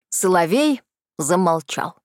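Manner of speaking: ordinary delivery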